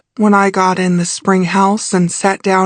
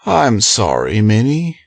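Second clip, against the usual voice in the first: male character voice; 5 semitones lower than usual. A narrator's reading. Male character voiced much lower.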